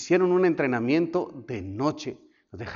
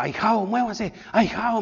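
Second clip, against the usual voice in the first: higher, eager coaxing; urging encouragement; 7 semitones higher, slower than usual. Retold or imitated. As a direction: push a stuck comrade forward. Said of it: "me daba voces de ánimo".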